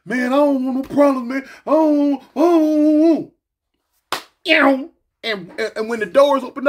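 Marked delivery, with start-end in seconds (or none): 0.1-3.3 high pleading, wants no trouble; scared backing down. Retold or imitated. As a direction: back off, sounding scared. Voices scared homie backing down.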